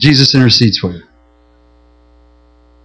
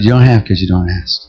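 lower, steady voice of God; calm God voice; 9 semitones lower than usual. Retold or imitated. God answering the frightened pastor, low and steady. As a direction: answer gently from above. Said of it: "He said".

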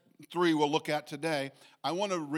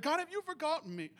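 high, pleading cry, softer at the end; plaintive cry to God; 12 semitones higher, 5 dB quieter, faster than usual. Retold or imitated. Preacher retelling his own prayer, a high plaintive cry. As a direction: ask God this as if close to tears. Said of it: "I've cried".

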